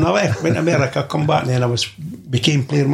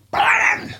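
high, hoarse mock shout of a surname; retold yell. Manager on the telly yelling at a player. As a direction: bellow the name across the pitch. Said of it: "I've seen him fucking on the tele".

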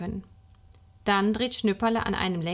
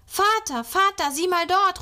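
put-on excited high, loud child's call; bursting out with a discovery; 10 semitones higher, 5 dB louder than usual. A narrator's reading. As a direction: call father urgently to look. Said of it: "ruft Schnüpperle".

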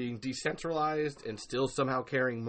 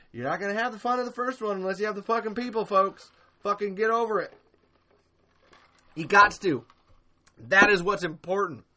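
louder, higher, fast exasperated rant; heated rant; 8 semitones higher, 8 dB louder than usual. Real, not acted. Host scolding fans about the people behind Tremors, heated rant. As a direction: vent at the fans, full tilt.

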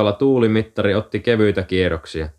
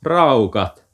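higher, exclaimed in contempt; short burst of exasperation; indignant huff; 4 semitones higher than usual. A narrator's reading. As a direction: blurt it out, fed up with them. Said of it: "Heli puuskahti".

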